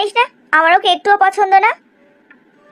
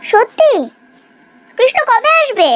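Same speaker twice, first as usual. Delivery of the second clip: surprised; 6 semitones higher, slower than usual. Acted. Mother hears son will come.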